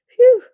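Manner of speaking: loud high phew of relief; relieved gasp. Acted. Jess lands on the grass worn out, relieved phew. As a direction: blow out a big relieved phew. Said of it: "she gasped".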